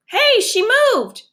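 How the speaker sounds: sudden shocked hey; startled exclaim. Acted. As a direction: jump back in alarm. Startled at the fish.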